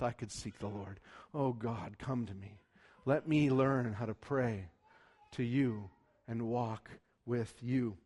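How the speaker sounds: low, soft voice of a humble believer praying; hushed heartfelt prayer. Acted. Discouraged believer asking God to come, hushed prayer. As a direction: pray it quietly, as if privately to God.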